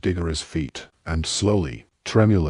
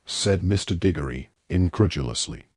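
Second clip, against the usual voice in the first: ordinary delivery, nothing marked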